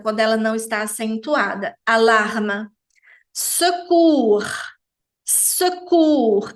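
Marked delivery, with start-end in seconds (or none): 3.3-6.5 secours com U francês e S final mudo; pronúncia francesa. Shown as a demonstration. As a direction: diga secours com biquinho. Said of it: "Aqui a gente tem o som do U". (secours em francês)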